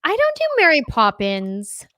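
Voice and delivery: hammed-up English accent; put-on British accent. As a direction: protest in a posh British accent. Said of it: "Americans always go to Mary Poppins".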